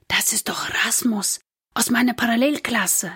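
urgent whisper of recognition; excited whisper. A narrator's reading. Gustav recognises a classmate from hiding, excited whisper. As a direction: whisper it, astonished, from a hiding place. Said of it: "flüstert Gustav aufgeregt".

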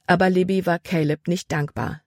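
ordinary delivery, nothing marked

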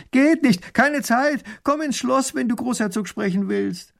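high-pitched shouted brush-off; calling back. A narrator's reading. Coachman shouts back to the woman, calling out high. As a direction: call it back over a distance. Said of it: "Erich ruft zurück".

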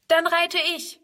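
put-on a forward little girl calling out; brisk call. A narrator's reading. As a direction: blurt it out as the bold girl. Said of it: "ruft Friedrun forsch".

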